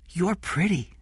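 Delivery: put-on high little boy's compliment; child's voice. Jeff compliments Kathy, child's voice.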